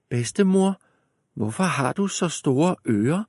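put-on high puzzled girl. A narrator's reading. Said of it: "spurgte Rødhætte".

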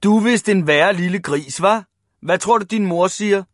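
loud bullying jeer; shouted taunt. A narrator's reading. Big boys teasing Ivan about his mother, jeering. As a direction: scold him mockingly at volume. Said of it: "råbte de".

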